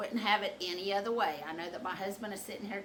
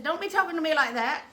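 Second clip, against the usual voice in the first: higher, louder mock scolding; indignant telling-off; 9 semitones higher, 10 dB louder than usual. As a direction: put him in his place, re-enacted. Acted telling-off.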